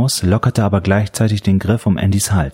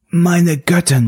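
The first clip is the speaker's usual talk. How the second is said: rattling gasp; 9 semitones higher, slower than usual. A narrator's reading. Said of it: "röchelte er".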